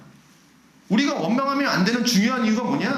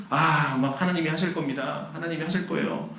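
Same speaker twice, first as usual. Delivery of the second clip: lightly acted pious reassurance, low and subdued; understated pretence of patient trust; outwardly pious; 6 semitones lower, 4 dB quieter than usual. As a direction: put on a mild show of faith, pitched down. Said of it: "겉으로는".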